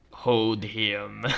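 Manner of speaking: low, drawn-out villain voice; sinister order. Acted. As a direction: give a slow, ominous command. Villain doctor ordering the patient held.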